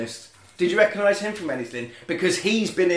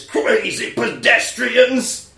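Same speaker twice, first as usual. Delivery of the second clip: loud drawn-out exclamation, pitched up; shouted movie line; 5 semitones higher, 5 dB louder, much slower than usual. Acted. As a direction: yell it slowly like a film bum's big line. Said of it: "he says a line in".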